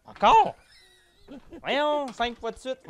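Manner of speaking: high, loud disbelief; surprised. Real, not acted. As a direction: shriek it in disbelief. Protesting the repeat.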